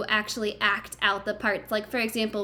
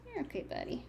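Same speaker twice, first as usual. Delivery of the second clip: soft, gentle comfort to a hurt buddy; soothing pretend doctor; 4 semitones higher, 13 dB quieter, much faster than usual. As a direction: hush and reassure a pretend patient. Playing doctor, comforting a friend.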